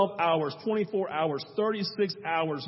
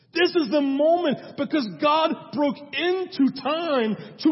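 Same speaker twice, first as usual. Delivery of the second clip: preaching; 9 semitones higher, 5 dB louder than usual. Acted. Preaching at full force.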